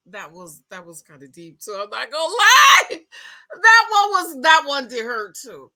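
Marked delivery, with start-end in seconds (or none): 1.7-5.7 laughing half crying. Real, not acted. Laughing crying over old breakup.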